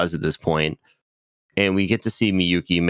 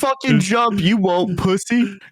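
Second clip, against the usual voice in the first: high, loud taunt; mock goading; 13 semitones higher, 4 dB louder than usual. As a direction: egg them on with a high-pitched jeer. Voicing a taunt to the jumper.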